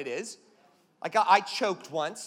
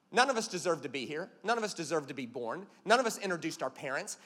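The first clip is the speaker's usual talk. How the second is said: normal talking voice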